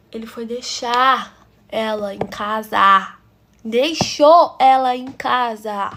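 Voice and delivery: loud, drawn-out indignation; indignant emphasis. Real, not acted. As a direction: stretch each word out slowly, loudly, incredulous. Indignant at him dropping her home.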